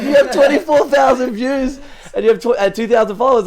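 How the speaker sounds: high, loud, stretched-out disbelief; freaking out. Retold or imitated. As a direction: imitate a starstruck fan reading numbers aloud. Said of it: "he was, like, freaking out".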